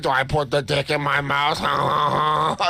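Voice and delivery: half-hearted fake French woman with fake laughs; a bad French accent, hammed up; bad French impression. Imitating an American woman, badly faked French accent. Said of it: "You're doing a bad French impression".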